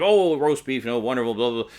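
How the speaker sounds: loud, high mock bluster; blustering on over everyone; blustery. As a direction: imitate a booming, boisterous dinner guest. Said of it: "being very blustery and so forth".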